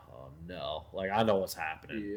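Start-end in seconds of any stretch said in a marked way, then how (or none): 0.1-0.9 low, soft muttered oh no; muttered dread. Retold or imitated. To himself as the guy holds the wad, quiet mutter. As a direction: say it under your breath, seeing trouble coming. Sensing trouble in security line.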